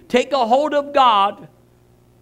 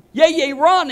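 louder, higher mock yell; imitated child's yelling; 6 semitones higher, 5 dB louder than usual. Granddaughter urging grandpa to run along, imitated yelling. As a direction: holler like an eager little granddaughter. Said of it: "she starts yelling at me".

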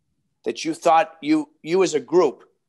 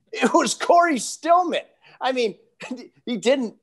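laughing; 10 semitones higher than usual. Real, not acted. Laughing at punchline of story.

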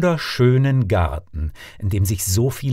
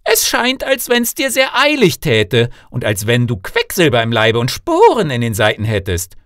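loud, high-pitched call from the king; 9 semitones higher, 5 dB louder than usual. A narrator's reading. King calls to the suitor about to leave, loud high call. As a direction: call after him in a raised, animated voice. Rief der König ihm zu.

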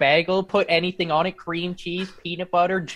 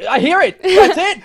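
higher, louder imitation of delighted listeners; excited mock outburst; 14 semitones higher, 10 dB louder than usual. Guest mimics people spotting his accent, in excited mock cries. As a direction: burst out as if catching someone out.

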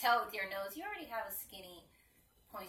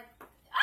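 high sudden yelp of pain; sharp cry of pain; 19 dB louder than usual. Real, not acted. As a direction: yelp as the clip snaps off. Clip pulled off, pain.

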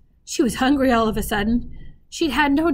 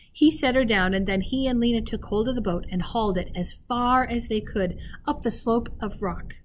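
usual speaking voice

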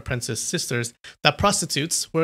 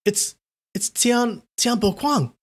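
frightened whisper; 9 semitones higher than usual. A narrator's reading. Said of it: "Yulin whispered".

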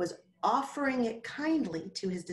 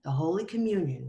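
plain reading voice

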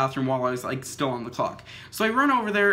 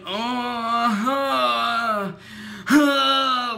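high, strained pained moans, louder at the end; imitated moaning; 5 semitones higher, 3 dB louder, much slower than usual. Retelling the injured rider's moans, imitated pained groaning. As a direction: mimic a hurt woman groaning on the road. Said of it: "she's, like, moaning".

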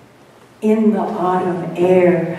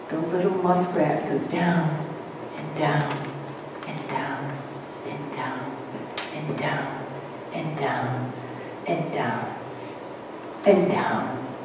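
each "and down" softer and lower, sinking away; fading into a hush; 5 semitones lower, 11 dB quieter than usual. A narrator's reading. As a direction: let the voice sink with the muskrat until barely a breath. Muskrat diving deeper and deeper.

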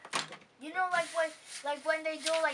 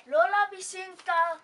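louder, projected call to grandma; louder greeting; 4 semitones higher, 7 dB louder than usual. Real, not acted. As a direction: speak up to grandma on the phone. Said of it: "You have to say it louder".

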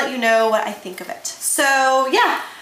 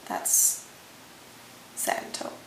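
hushed whisper of the shade name; soft unvoiced whisper; 11 dB quieter, slower than usual. Real, not acted. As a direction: whisper it like a secret.

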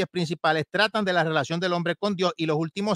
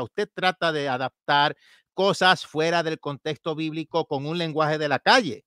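normal talking voice

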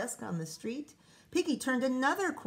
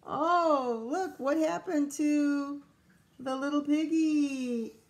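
mock dismay; 5 semitones higher, 5 dB louder, slower than usual. Real, not acted. Reader dismayed at eaten cookie.

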